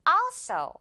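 also with a long, strong first syllable; stress on first syllable. Shown as a demonstration. Teacher models correct pronunciation, front-stressed also. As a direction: let the al of also win over so. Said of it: "앞에 있는 음절이 오늘 이깁니다".

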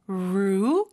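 "루" with the oo vowel held long. Shown as a demonstration. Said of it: "우 발음 길게".

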